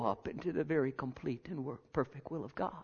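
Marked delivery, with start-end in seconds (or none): 1.9-2.7 soft unvoiced whisper. Real, not acted. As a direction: breathe it like a private prayer. Prayer voiced intimately.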